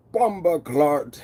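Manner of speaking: slow drawn-out Jamaican patois; lightly put-on Jamaican accent. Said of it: "D J says".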